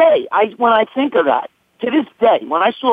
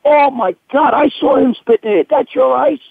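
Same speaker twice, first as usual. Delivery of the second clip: higher-pitched imitation of horrified friends; retold shocked reaction; 7 semitones higher than usual. Friends who saw him spit in the ice, appalled. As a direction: mimic their appalled disbelief.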